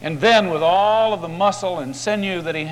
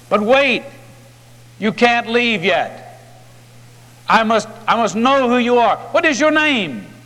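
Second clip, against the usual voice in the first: much higher and louder, grabbing at him; don't let him go, cry out after him; desperate plea; 7 semitones higher, 4 dB louder than usual. A narrator's reading. Jacob clutching the stranger's cloak, desperate high cry.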